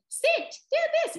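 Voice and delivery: very high, loud squeaky command; high-pitched command. Shown as a demonstration. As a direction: yap the order like a tiny dog. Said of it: "you sound like them barking".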